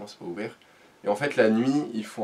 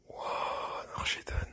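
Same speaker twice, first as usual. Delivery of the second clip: scared whisper; 9 dB quieter than usual. Retold or imitated. Scared whisper about the cat in the night.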